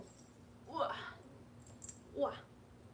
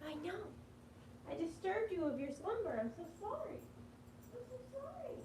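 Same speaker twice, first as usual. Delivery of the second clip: soft pet apology; 7 semitones higher, 7 dB quieter than usual. Real, not acted. Apologising to sleeping cat.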